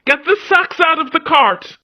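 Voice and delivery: high, loud commanding character voice; barked order. A narrator's reading. Leader ordering his clan to unload the cart, commanding. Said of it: "he ordered".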